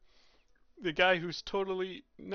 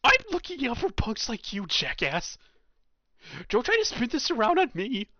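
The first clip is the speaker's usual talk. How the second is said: higher, louder scolding yell; angry hall monitor; 9 semitones higher, 8 dB louder than usual. Acted. Hall monitor yells at the student caught past curfew, angry. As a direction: bust the rule-breaker loudly.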